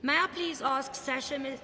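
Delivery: normal talking voice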